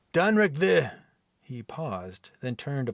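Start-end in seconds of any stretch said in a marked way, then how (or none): 0.1-0.9 proud declaration. Acted. Viking proclaiming name.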